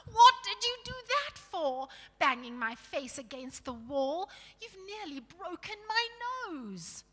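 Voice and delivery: high, loud howl of complaint. A narrator's reading. Dwarf rubbing his banged nose, howling protest. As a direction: wail in outraged pain. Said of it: "rubbing his nose and howling".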